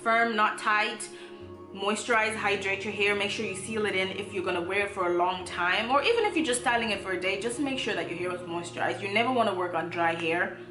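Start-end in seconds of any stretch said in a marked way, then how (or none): none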